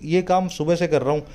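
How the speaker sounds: ordinary delivery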